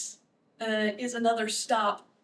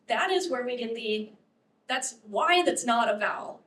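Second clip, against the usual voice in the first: normal talking voice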